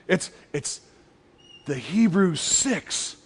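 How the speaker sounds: slow, quiet, knowing hippie voice; mystical hippie. Retold or imitated. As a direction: lean in like sharing a dark secret, slowly. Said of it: "one of these old hippie dudes".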